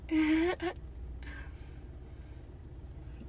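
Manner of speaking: airy unvoiced giggle; breathy laugh. Real, not acted. Laughs at own description.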